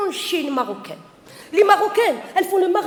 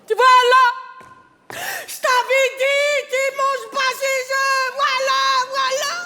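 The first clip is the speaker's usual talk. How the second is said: a mother crowing that she was right; mom's told-you-so; 7 semitones higher, slower than usual. Retold or imitated. A mother proving her daughter wrong, in a high triumphant cry.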